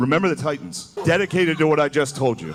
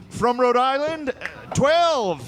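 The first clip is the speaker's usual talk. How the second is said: high-pitched, slow mock announcement; first congressman voting; 11 semitones higher, much slower than usual. Congressman going first on age of consent, high mock announcing voice. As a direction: call it out high and drawn-out, like a roll call. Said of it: "some guy coming up first".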